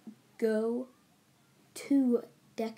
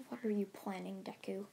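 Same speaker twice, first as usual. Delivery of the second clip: hushed whispered question; 7 dB quieter than usual. Retold or imitated. Aizawa asks Deku his plan, whispering. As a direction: whisper the question under your breath. Aizawa voiced in retold story.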